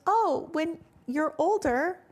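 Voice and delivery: high-pitched quoted doctor voice; imitated doctor. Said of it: "Brazilian doctor said when".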